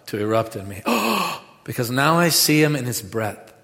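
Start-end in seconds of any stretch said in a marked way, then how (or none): 0.9-1.4 sharp high intake of breath in wonder; amazed gasp; awed gasp. Acted. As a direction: gasp as if seeing it. Awe at Jesus.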